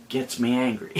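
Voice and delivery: sigh, then a soft laugh; rueful laugh. Real, not acted. After his angry rant, a quiet self-mocking laugh.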